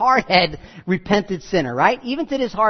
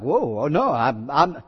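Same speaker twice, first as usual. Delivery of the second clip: lower, hesitant mock retreat; backpedalling child; 7 semitones lower than usual. Kid answering a threatening parent, mock backing down. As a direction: stammer a hasty climb-down.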